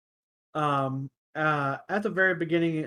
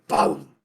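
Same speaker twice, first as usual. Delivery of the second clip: loud, punchy vocal explosion; imitated impact boom; 7 dB louder than usual. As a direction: punch out the hit sound. Imitates the shot landing.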